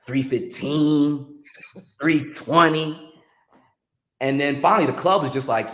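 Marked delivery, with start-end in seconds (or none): 0.1-3.1 drawn-out counting. Real, not acted. Counting late hours slowly.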